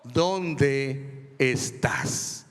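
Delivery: slow, loud, drawn-out divine call; God calling out. Acted. Preacher reading God calling Adam, slow and loud. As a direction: call out slowly like God in the garden.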